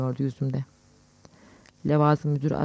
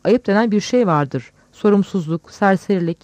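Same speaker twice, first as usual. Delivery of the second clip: loud, higher-pitched scolding; angry shout; 5 semitones higher, 7 dB louder than usual. A narrator's reading. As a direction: scold them with a raised voice. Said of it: "diye bağırdı". (yüksek sesle öfkeli azarlama)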